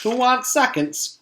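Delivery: high calling lunch lady. Acted. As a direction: offer seconds to everyone. Called Miss Mush.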